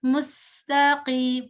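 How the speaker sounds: slow मुस्तकीम with a light whistle on the स; whistled seen. Shown as a demonstration. Teacher showing the whistle of seen for the student. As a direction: let the स in मुस्तकीम whistle a little. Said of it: "सीन के अंदर आपको थोड़ी सी whistle सुनाई दे रही".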